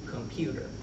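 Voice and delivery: normal talking voice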